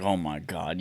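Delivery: under the breath, low and soft; muttered aside. Retold or imitated. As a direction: mutter it to yourself. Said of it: "And I was just like".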